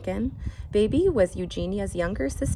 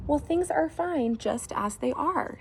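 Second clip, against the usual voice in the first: higher, cheerful reassurance; content husband; 9 semitones higher than usual. Acted. Husband reassuring his wife, lightly voiced. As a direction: brush it off brightly.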